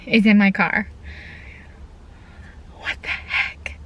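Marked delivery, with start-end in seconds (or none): 2.8-3.8 stunned whisper. Real, not acted. Whispering in disbelief about the dress in the car, stunned. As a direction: breathe it out in disbelief. Unvoiced, soft.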